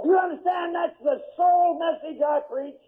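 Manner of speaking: full-force pulpit preaching; fiery preaching. Acted. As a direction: thunder it at the congregation.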